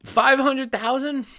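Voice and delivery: high, slow disbelieving five hundred thousand; can't believe the number; incredulous. Real, not acted. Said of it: "incredulous at the prediction".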